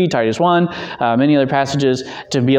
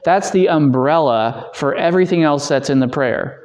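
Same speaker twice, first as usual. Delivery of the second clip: normal talking voice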